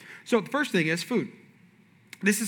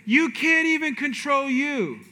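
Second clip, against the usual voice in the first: slow blunt pastor; 6 semitones higher, slower than usual. Retold or imitated. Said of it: "He said".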